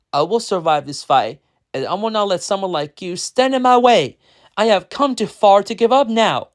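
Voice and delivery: high, forceful story shout. A narrator's reading. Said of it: "he shouted".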